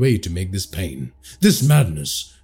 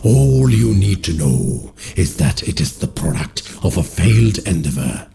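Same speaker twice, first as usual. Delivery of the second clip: lower, louder, ominous entity voice; low commanding otherworldly voice; eldritch voice; 5 semitones lower, 3 dB louder than usual. A narrator's reading. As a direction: speak as an ancient dark being. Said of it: "the dark entity speaks".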